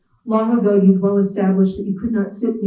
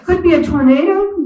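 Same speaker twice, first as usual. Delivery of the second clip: much higher and louder Appalachian drawl; mock Appalachian boss raising a silly alarm; hammed-up Appalachian accent, pitched up; 10 semitones higher, 5 dB louder than usual. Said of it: "Appalachian drawl".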